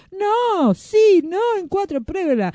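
high-pitched quarrelsome back-and-forth; grumbling argument. A narrator's reading. Magician and wand-maker bickering over cutting, grumbling. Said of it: "refunfuñando el fabuloso mago Kedramán".

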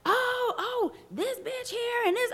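high, brash mimic of a rude woman; high mocking loudmouth voice. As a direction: squeal it like a tacky loudmouth. Mimicking loud cussing woman.

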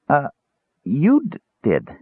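halting stammer, pitch jumping up; high, hesitant stutter. A narrator's reading. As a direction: stumble over the words with a false start. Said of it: "Tass stammered".

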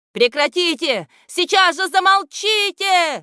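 high, loud furious yell; furious shout. A narrator's reading. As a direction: roar them into silence. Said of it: "яростно закричал".

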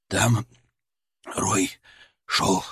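wheezing, weak and hoarse; hoarse wheeze. A narrator's reading. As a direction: croak it out like a concussed man. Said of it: "прохрипел Тор".

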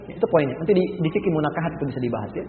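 ordinary delivery

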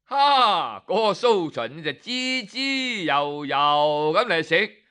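usual speaking voice

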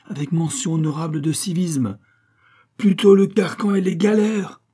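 building to a high loud outcry; indignant. Acted. Abbé refusing Pitou, loud outraged cry. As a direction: rather the galleys, cry it out.

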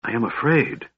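a touch of fear, half-whispered; fearful whisper. A narrator's reading. Alhana confiding in Tanis about her father, fearful whisper. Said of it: "Alhana whispered".

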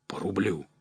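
breathless whisper of disbelief; shocked whisper. Acted. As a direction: whisper it, stunned by the low price. Egor stunned by the price.

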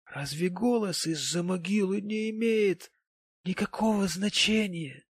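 high, plaintive reproach; tender reproach. A narrator's reading. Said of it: "с нежным укором".

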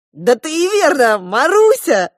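high, loud cry of recognition; exclaiming. A narrator's reading. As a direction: exclaim as it dawns on you. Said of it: "воскликнул папа". (радостно и громко восклицая)

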